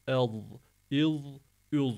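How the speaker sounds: "El, il, ül" with heavy voiced th endings; thick lisp. Shown as a demonstration. Teacher demonstrating za, thick lisped "El, il, ül". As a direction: buzz darkly with tongue between front teeth. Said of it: "Ön dişlerimizin arasına alarak kalın".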